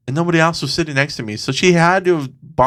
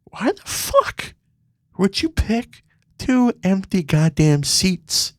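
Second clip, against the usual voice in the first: exasperated; 6 semitones higher, slower than usual. Real, not acted. Exasperated rant.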